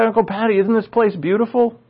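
put-on a touch of a marvelling kid; amazed kid. Amazed child asking uncle about the view, mild wonder.

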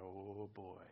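low, soft "uh-oh" to oneself; low dismayed aside. Retold or imitated. Parent eyeing a child nearing the teens, low dismay. Dreading a child's teen years.

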